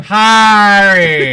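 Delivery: loud, high, drawn-out "Harry" with broad "ah"; exaggerated attempt. Shown as a demonstration. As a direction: overdo "Harry" as a long "Haaah-ree". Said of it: "work on it that way".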